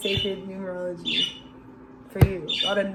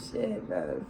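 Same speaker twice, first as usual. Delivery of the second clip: muttered low; 11 dB quieter than usual. Real, not acted. Repeats phrase, dropping it low.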